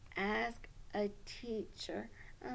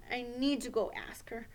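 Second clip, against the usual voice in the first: usual speaking voice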